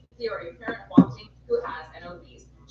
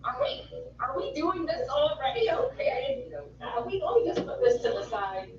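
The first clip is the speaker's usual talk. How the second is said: high, pompous little mayor speech; Mayor of Townsville voice; 5 semitones higher than usual. Acted. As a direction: address the citizens as a silly mayor.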